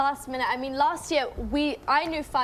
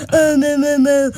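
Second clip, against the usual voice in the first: imitating Finn; 11 dB louder, slower than usual. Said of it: "and Finn was like".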